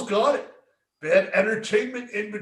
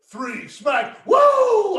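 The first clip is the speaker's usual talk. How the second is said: Flair woo; 10 semitones higher, 8 dB louder, much slower than usual. Retold or imitated. Retelling Ric Flair's slap count, ending in a loud woo. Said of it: "Ric Flair going".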